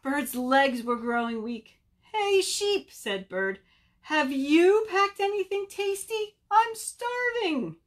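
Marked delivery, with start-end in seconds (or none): 2.1-2.9 high-pitched bird hailing a friend; calling out. Acted. Weakening Bird calls to Sheep, calling out. 4.0-7.8 high, insistent bird whine, slow at the end; starving plea. Acted. Starving Bird begs Sheep for food, pleading.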